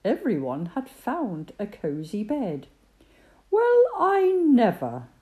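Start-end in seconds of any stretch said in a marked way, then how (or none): 3.5-5.1 high, louder amazed exclamation; surprised. A narrator's reading. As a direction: marvel at the sight. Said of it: "said Percy".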